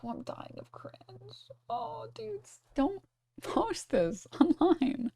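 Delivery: high, soft voice mocking a chatter; cringing chatter. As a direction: put on a high, hushed mock-cringe. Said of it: "then this chatter says".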